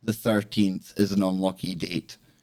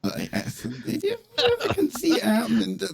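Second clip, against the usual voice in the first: laughing; 11 semitones higher than usual. Real, not acted. Laughing about ASMR idea.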